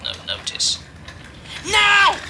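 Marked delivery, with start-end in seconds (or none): 1.6-2.2 high, loud shout of Now. A narrator's reading. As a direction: yell the signal to push. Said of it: "shouted the front truck".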